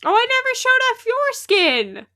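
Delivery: high, loud, excited exclamation; excited realization. Real, not acted. Streamer remembers to show off player's skin, high excited exclamation.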